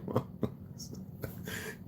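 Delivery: soft, breath-only chuckle; quiet laugh. Real, not acted. Streamer tickled by his own mimicry, quiet laugh.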